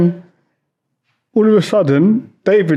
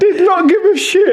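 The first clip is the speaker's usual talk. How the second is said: amused; 11 semitones higher than usual. Retold or imitated. Laughing retelling.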